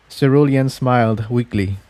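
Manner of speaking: ordinary delivery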